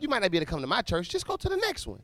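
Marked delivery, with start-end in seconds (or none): 1.1-2.0 mocking pastor voice.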